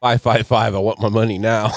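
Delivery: loud TV-ad shout; mock commercial shout; ad parody. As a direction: yell it like a late-night commercial. Parodying an annuity TV ad.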